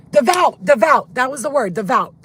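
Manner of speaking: loud, high burst of excitement; triumphant recall. Real, not acted. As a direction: shout out the word you finally remembered. Suddenly recalls the forgotten word.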